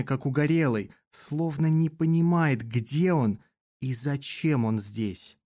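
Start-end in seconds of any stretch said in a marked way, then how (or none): none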